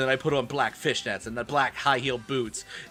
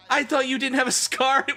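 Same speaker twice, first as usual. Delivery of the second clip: high character voice; 12 semitones higher, 3 dB louder than usual. Acted. Character line, high pitched.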